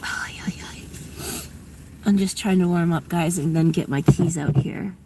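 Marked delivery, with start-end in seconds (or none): 0.0-0.8 unvoiced shivering ay ay; shivering whisper. Real, not acted. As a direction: breathe it out cold. Cold in the truck.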